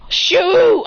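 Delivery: loud, high exclamation; high, loud cry of annoyance; frustrated outburst. Real, not acted. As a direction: burst out in frustration. Speaker frustrated by game barrier.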